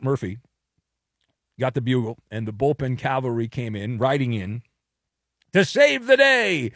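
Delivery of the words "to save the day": mock-heroic cry. Cavalry rides in to rescue.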